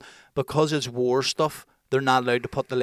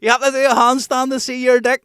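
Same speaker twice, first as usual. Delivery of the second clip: high, loud taunt yelled down from a window; mock shouting; 12 semitones higher, 9 dB louder than usual. Prisoners yelling insults down at the presenter, high and loud. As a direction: heckle him from above. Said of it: "they were shouting down".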